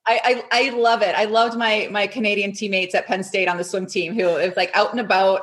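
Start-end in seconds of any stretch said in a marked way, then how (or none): none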